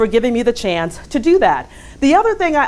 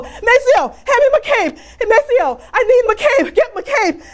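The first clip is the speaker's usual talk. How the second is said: [name] plea; 13 semitones higher than usual. Retold or imitated. Coworker imitating [name] begging departing James to stay. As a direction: beg like a soul singer on his knees. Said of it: "in the words of another famous James".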